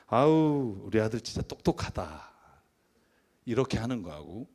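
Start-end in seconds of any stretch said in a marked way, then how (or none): 0.1-2.3 tender, unforced compliment; everyday warm praise. Shown as a demonstration. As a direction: say it gently, like a proud parent at home. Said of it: "그냥 평소에 어투로 해볼게요".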